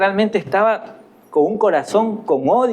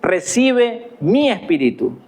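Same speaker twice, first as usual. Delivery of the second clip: slow, raised, tender plea to the Lord; dying prayer; 6 semitones higher, slower than usual. Acted. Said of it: "Esteban oró y le dijo".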